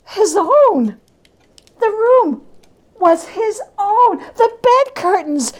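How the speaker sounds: high, breathless joy; giddy relief. Acted. Scrooge finding himself home in bed, giddy and high. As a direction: bubble over with disbelieving delight.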